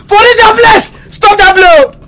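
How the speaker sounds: crying shout. Acted. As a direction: cry out in pain and blame. Wounded friend wails at Onini.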